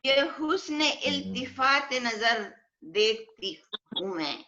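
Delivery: poetry reading at a mushaira; ghazal recitation. Acted. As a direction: recite it as an Urdu couplet, lingering on the refrain.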